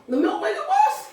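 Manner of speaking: high laughing exclamation. Real, not acted. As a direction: laugh the words out in disbelief. Laughs at failed milk remedy.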